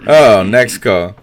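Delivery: usual speaking voice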